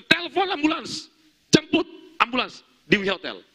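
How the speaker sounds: high, loud emergency command; urgent shouted order. Retold or imitated. Pastor ordering an ambulance for a collapsed man, urgent shout. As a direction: call out for urgent help, re-enacted.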